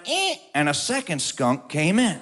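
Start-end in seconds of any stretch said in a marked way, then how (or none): 0.1-0.4 a short, high creaky squeak like a screen door; screen door creak. Retold or imitated. Teller mimics the screen door opening, high creak. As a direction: squeak it out like an old door swinging open. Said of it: "the screen door went".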